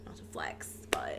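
unvoiced conspiratorial whisper. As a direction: whisper it like a secret. Whispered repeat of aside.

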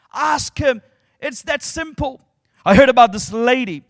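normal talking voice

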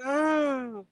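high, slow mock whine; hammed-up crybaby whine; mock crying. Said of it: "You know what I mean?".

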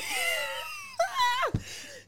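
very high loud laughing shriek. Real, not acted. To friend, laughing at manager's Brixton flat, high shrieking laugh. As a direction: burst into a squealing laugh.

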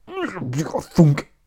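high, punched mouth sound effect; comic-book thunk noise; imitated impact thunk. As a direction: make the sound of a blade hitting.

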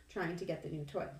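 usual speaking voice

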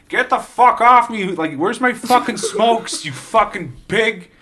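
high, loud, drawn-out protest; rowdy Canadian lad. Acted. Canadian lad protesting to police as they grab him, loud indignant yell. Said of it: "classic Canadian lads who are just like".

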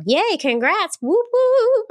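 high-pitched whooping congratulations; celebratory cheer. Real, not acted. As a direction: cheer for the new parents, rising into loud whoops. Cheers the listener's new baby.

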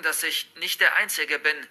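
plain reading voice

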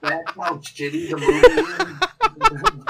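loud, high, slow words through laughter; laughing hard. Real, not acted. Mocking the remake to a co-host, laughing hard. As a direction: squeal it out while cracking up. Both cracking up over the line.

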